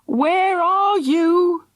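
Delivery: high, very slow, loud call. A narrator's reading. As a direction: call out searching. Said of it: "called Mister Love".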